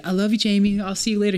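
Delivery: high, sing-song teacher fondness; mock-sweet goodbye. Imitating mom saying goodbye to her student, sweet and high.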